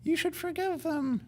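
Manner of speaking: very high, slow, sweet little angel; angel on the shoulder. Acted. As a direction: pipe up as a tiny conscience. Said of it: "angel on your shoulder is like".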